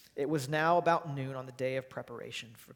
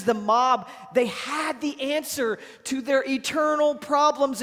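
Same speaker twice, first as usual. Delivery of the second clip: loud, high, driving sermon delivery; full-force preaching; 8 semitones higher, 8 dB louder than usual. Acted. Pastor preaching to his church, loud and driving. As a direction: hammer it home to the church, pleading and forceful.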